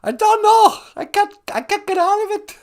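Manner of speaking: loud, high, flustered Irish-tinged falsetto; stuck Irish-sounding accent. Acted. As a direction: wail helplessly in a botched Irish accent. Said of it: "why do you sound kinda Irish?".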